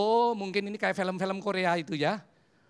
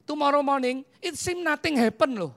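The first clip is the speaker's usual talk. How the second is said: usual speaking voice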